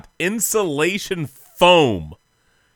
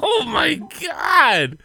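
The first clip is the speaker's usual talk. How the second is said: horrified oh my God; 14 semitones higher, much slower than usual. Real, not acted. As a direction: drag it out in horror. Horrified reaction.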